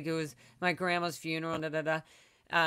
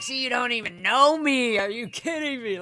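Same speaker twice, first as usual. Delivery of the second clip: indignant outburst; 5 semitones higher, 4 dB louder than usual. Retold or imitated. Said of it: "I was like".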